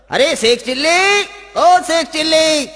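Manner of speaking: high, drawn-out call to someone out of sight. Acted. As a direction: summon the servant loudly. Master angrily summons his servant.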